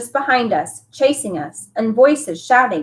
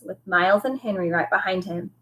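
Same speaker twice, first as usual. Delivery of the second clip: plain reading voice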